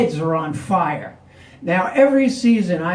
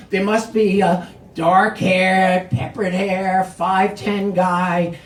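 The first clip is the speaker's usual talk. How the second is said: usual speaking voice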